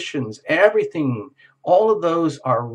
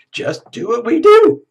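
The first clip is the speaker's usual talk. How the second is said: insistent repeat; 10 semitones higher, 9 dB louder than usual. Retold or imitated. Happy people repeat, louder.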